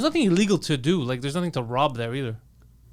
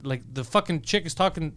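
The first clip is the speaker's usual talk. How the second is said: normal talking voice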